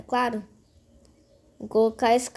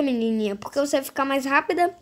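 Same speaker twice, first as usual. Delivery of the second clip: usual speaking voice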